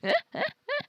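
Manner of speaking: high squeaky laugh; high giggle. Real, not acted. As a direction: burst into a giggle. Laugh after calling out.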